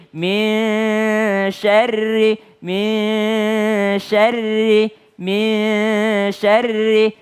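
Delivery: MIN with the nun melted into a long hum before SYARRI; correct ikhfa. Shown as a demonstration. Teacher modelling min syarri for students, correct ikhfa. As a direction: hold MIN in a hidden nasal hum, then SYARRI. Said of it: "bukan min".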